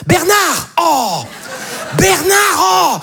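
high, sharp parental yell; mother calling out. Acted. Mother running after her four-year-old, high mock shouting.

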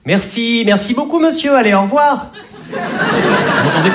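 high, insistent sing-out courtesy; exasperated mock-courtesy; fed-up fake politeness. Shown as a demonstration. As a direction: send them off with over-polite high-pitched thanks. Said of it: "quand on en a vraiment marre".

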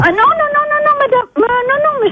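high, rapid string of protesting noes; alarmed refusal. Real, not acted. Worker refusing a stranger's offer to dismantle the shopfront, alarmed. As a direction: protest urgently, pitch jumping up. Refuses the caller's change of sign.

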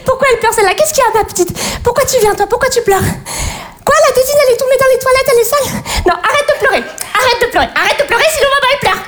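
plead, close to tears yourself; overwhelmed mother. Acted. Mother pleads with crying baby.

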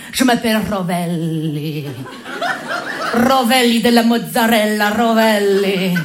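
low, slow crooning Italian charmer; Italian lover voice. Acted. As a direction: purr your name like a Latin lover. Se présente en séducteur italien. (voix de séducteur italien, lente et grave)

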